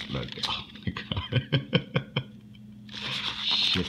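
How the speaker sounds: quiet, whispered laugh; breathless laughing. Real, not acted. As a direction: laugh under your breath in disbelief. Laughing at the Mega Crate.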